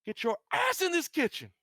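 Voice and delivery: high, loud screeched order; shrieky yell. Acted. Woman ordering Dave into the kitchen, high shrieky yell. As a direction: screech it like a furious summons. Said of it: "very shrieky scream as she yells".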